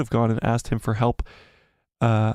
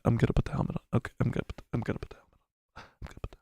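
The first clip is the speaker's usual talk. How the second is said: whispering; 8 dB quieter, much faster than usual. Real, not acted. Teasing before putting helmet on.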